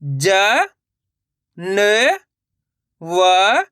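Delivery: ज, न, व each separate, held long and rising; letter-by-letter demo. Shown as a demonstration. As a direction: sound out ज, न, व with pauses between. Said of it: "चलिए एक बार फिर से देखिए". (ज, न, व अलग-अलग खींचकर)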